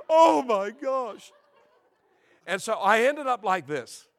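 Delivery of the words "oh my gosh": loud, high, drawn-out dismay; mock horror. Vain young man seeing his hair, mock-horrified cry. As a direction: wail it slowly in comic horror.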